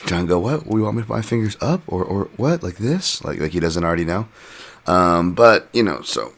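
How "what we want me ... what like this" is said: higher, questioning imitation of a clueless fighter; feigned confusion. Fighter playing dumb to the referee about his fingers, mock confusion. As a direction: act baffled, pitching the questions up. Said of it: "we had to watch that theater go on".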